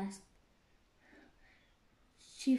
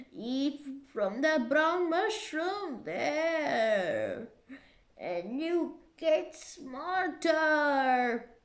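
slow, drawn-out creature voice; caterpillar voice; 3 semitones higher, 6 dB louder, slower than usual. Acted. Caterpillar instructing Alice about the mushrooms, slowly. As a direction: spell it out slowly like a wise old caterpillar.

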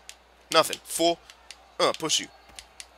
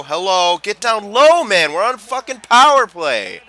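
high, loud exasperated shout; angry yelling; 7 semitones higher, 10 dB louder, slower than usual. Real, not acted. Gamer to teammates on a power play, angry yell. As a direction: yell orders at useless teammates.

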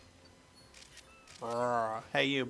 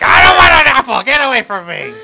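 very loud, high mock-angry shout; angry Pokemon; 10 semitones higher, 19 dB louder than usual. Player voices Pokemon who did not want apple, mock-angry shout. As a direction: yell as a furious creature refusing food. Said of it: "He was like".

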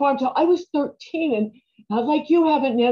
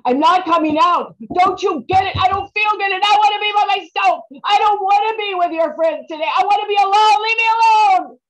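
yelled, higher and louder, rising to a scream; furious shout; 8 semitones higher, 6 dB louder than usual. Acted. Teen girl yelling at her mother through the locked door. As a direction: scream through the bathroom door to be left alone.

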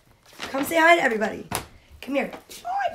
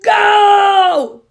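loud, high, drawn-out holler; yell; 7 semitones higher, 7 dB louder than usual. A narrator's reading. Pig starting the eating race, loud yell. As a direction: yell it long like a starting call. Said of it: "Pig had yelled".

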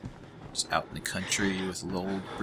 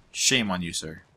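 usual speaking voice

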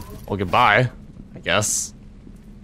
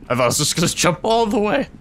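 rising excitement; 11 semitones higher than usual. Real, not acted. Player imagining jumping all the way down, rising excitedly. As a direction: pitch climbing high through the line.

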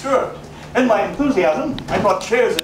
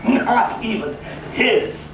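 drawn-out, a touch higher, savouring it; slow gloating aside; slow sly gloat; 4 semitones higher, slower than usual. Acted. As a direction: savour every word like a secret.

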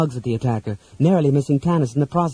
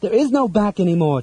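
restrained, high-pitched cry; wild cry; 7 semitones higher than usual. A narrator's reading. Tika to companions seeking a back way out, crying out. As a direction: cry it out higher, with a touch of panic. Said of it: "Tika cried wildly".

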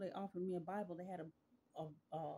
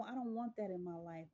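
usual speaking voice

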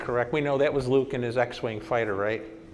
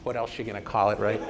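ordinary talk, nothing marked

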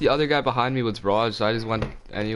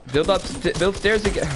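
urgent; 7 semitones higher than usual. Real, not acted. Urgent instruction.

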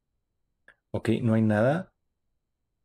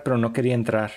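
ordinary delivery

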